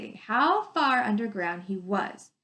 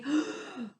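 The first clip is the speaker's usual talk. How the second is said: gasp; 4 semitones higher, 5 dB quieter than usual. Acted. Teacher acting awe at image.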